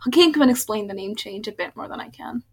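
ordinary delivery

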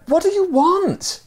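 very high, drawn-out yelled question; high-pitched exasperated shout; acted yell. A narrator's reading. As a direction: yell it down over the edge. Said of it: "yelled to him again".

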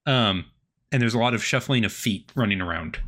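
ordinary talk

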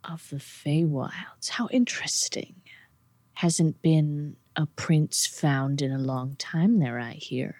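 hushed demon savouring a discovery; intrigued demon. Acted. Game master voices intrigued demon.

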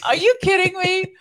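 high and loud, utterly disbelieving; incredulous laugh. Retold or imitated. Friend hearing she is a housewife, high disbelief. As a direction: toss it off like the idea is absurd. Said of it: "She's like".

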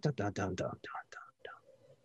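pleading, dropping to a whisper; fading to whisper. Acted. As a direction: let it die into a whisper.

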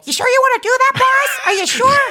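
high, irritating cartoon helper voice; annoying Clippy. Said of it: "Really annoying and irritating".

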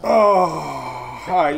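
light up at the puppy; excited greeting. Real, not acted.

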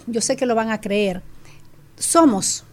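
normal talking voice

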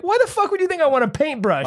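high incredulous question; baffled disbelief at the pitch. Retold or imitated. Comic to paintbrush seller, high disbelief.

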